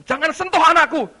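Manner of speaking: loud, high, commanding warning; protective shout. Acted. God warning the devil away from his child, protective shout. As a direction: shout it as a fierce parent's order.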